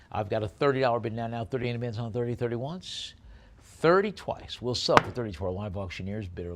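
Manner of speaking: fast, punchy auctioneer patter; auction bid calling. Acted. Auctioneer to online bidders, brisk bid calling. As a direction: rattle off the bids and knock it down.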